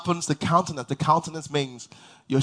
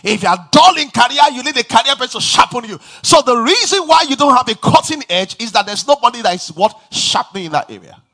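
louder, higher preacher's call to the congregation; preaching at full force; 7 semitones higher, 9 dB louder than usual. Acted. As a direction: preach it out, building fire.

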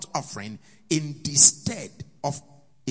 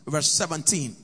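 ordinary delivery

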